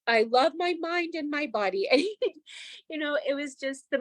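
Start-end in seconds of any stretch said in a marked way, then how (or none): 0.1-1.9 high, light child's voice imitating her ten-year-old; son's voice. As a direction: voice the little boy tapping sincerely. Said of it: "He was like".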